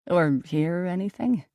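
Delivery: slow follow-up; slow question. Acted. Perry asking the twins if they heard anything, slow. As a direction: let it trail out slowly. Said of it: "I asked slowly".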